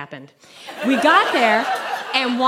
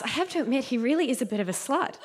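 quiet aside; 2 semitones higher, 7 dB quieter, faster than usual. Acted. Muttered admission about Gary.

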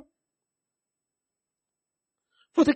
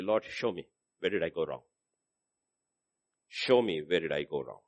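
much softer, lower, searching plea; humble quiet prayer; 9 semitones lower, 6 dB quieter than usual. Retold or imitated. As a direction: pray low and humbly, asking. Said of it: "I take responsibility".

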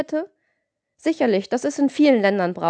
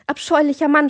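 higher, louder cry of disgust; screaming; 6 semitones higher, 4 dB louder than usual. A narrator's reading. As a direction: hurl it at him in despair. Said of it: "schrie Justine". (verzweifelter Schrei)

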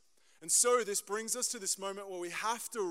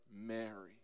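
tender call; 10 semitones lower, 10 dB quieter than usual. Acted. Jesus tenderly calling Mary.

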